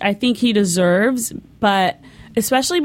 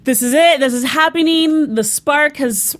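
excited; 5 semitones higher than usual. Retold or imitated. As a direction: gush like the moment has finally come. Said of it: "I was like".

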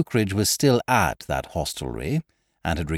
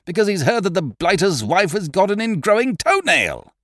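higher, insistent demand; 10 semitones higher, 4 dB louder than usual. Acted. Eucridge demanding guarantees from the narrator. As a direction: press the question hard.

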